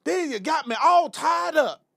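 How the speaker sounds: high, slow, loud mock orator; drawn-out mock old-time activist holler. Host mocking the congressman's lingo, hammed-up old activist. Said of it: "try to have the lingo of a old".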